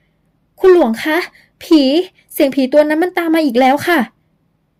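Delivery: put-on startled young woman. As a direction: gasp it out to Luang, scared and high.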